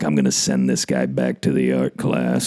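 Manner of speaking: low mock coach voice; Rhule. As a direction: drop it low, a scheming coach. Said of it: "So you know Rhule's like".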